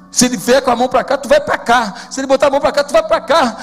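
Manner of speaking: loud, rising, quick pulpit drive; emphatic preaching. Acted. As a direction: build the point with each phrase.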